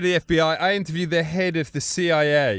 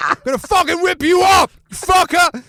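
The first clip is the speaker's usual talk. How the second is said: much higher and louder, a hammed-up yell; mock furious shout; 8 dB louder than usual. Imitating Alabama fans threatening him, a mock furious shout. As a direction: bark it like an angry football crowd. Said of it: "people coming up going".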